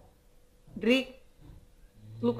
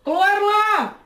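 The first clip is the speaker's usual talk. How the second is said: shouting; 9 semitones higher, much slower than usual. Acted. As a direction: yell through the door. Debt collector calling at door.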